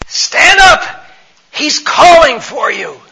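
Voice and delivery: loud, high excited shout; crowd's high-pitched, excited yell; urgent calling out. Acted. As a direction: call him to his feet at full voice. Crowd urgently calls the blind man.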